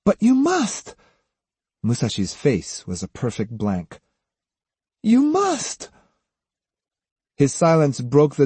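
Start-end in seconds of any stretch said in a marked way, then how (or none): 0.0-0.9 very high, pleading voice; high, anguished insistence; desperate plea. A narrator's reading. Pleading to be remembered. 5.0-5.9 thin, very high begging voice; high, anguished last appeal; desperate plea. A narrator's reading. Repeats plea, close to sobbing.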